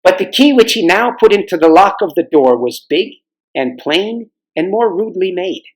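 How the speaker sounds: usual speaking voice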